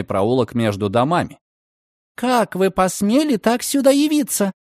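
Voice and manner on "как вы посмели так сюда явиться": high, tense furious hiss. Acted. Girl confronting the man in a dark alley, furious hiss. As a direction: hiss it at him in outrage. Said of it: "яростно прошипела девушка".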